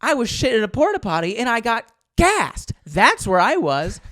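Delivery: high, loud, indignant rant; furious retort. Retold or imitated. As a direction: spit out the humiliating truth. Furious answer to buddies.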